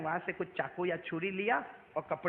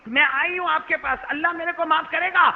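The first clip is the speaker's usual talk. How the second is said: higher, louder, begging; desperate plea; 9 semitones higher, 11 dB louder than usual. Acted. The woman begs Abu Huraira to know if God forgives, desperately. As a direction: cry out the question in despair.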